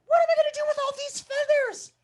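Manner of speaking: high, fast fussing animal voice; fretting animal. Acted. Storyteller voices a fussing animal, high and fast. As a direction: wail about the feathers, quick and high. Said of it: "Another one said".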